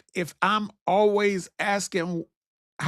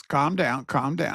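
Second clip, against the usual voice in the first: lower, easy "calm down"; joking reassurance; 5 semitones lower than usual. Real, not acted. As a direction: soothe them with a grin.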